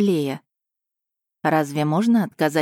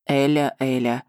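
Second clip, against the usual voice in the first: sighing; 3 semitones lower than usual. A narrator's reading. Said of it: "вздохнул Эйден".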